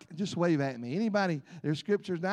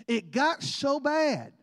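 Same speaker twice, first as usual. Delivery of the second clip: slow emphasis; 4 semitones higher, much slower than usual. Acted. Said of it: "now this is in your Bible".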